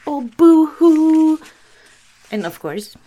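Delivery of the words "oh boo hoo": high, loud, slow sarcastic boo-hoo; mock boo-hoo. Sisters mocking their own fake sadness, high and loud. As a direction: sneer it like a fake sob. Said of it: "being like".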